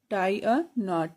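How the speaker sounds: tie a knot slowly, every word drawn out; slow it down for pupils to copy; slow drill. Shown as a demonstration. Teacher repeating the answer slowly for the class. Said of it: "English में कैसे बोलेंगे".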